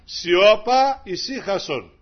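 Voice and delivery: loud high Σιώπα, then calm ησύχασον; commanding the sea. Acted. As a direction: rebuke the storm. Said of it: "θα πει στο πρόβλημα". (προσταχτικά, δυνατό Σιώπα)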